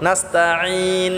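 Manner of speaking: "নাস্তাঈন" with the ain pressed in the throat; slow "নাস্তাঈন", long squeezed final ain; long throaty ain. Shown as a demonstration. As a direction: draw out the last vowel of "নাস্তাঈন" for three alifs. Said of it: "ইনটা আমরা গলায় চাপ দিয়ে তিন আলিফ টেনে পড়বো".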